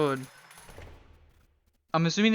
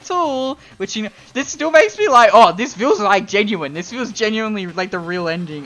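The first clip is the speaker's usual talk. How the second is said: excited; 6 semitones higher, 11 dB louder than usual. Real, not acted. Excited about the ending.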